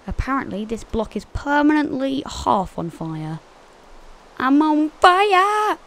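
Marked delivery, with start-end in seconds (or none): 4.4-5.8 slow, high, loud exclamation; mock panicked shout. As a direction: yell it drawn-out and high. Jokes about being on fire.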